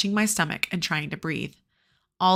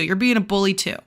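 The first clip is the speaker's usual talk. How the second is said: ordinary delivery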